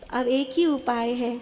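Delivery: usual speaking voice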